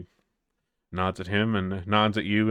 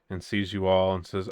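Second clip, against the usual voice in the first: normal talking voice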